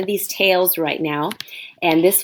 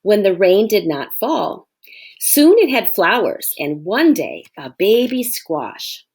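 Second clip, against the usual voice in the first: ordinary delivery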